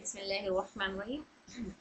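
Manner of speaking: soft quick recited basmala; recitation. Acted. Teacher opening practice with the basmala, soft. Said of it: "आइए इसके words की practice करते हैं".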